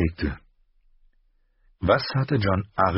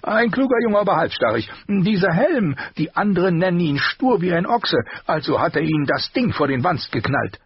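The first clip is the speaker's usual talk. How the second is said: higher, sharper armourer voice; the master's voice; 12 semitones higher, 4 dB louder than usual. A narrator's reading. Armourer rebuking his apprentice, higher voice. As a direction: scold the boy in a raised voice.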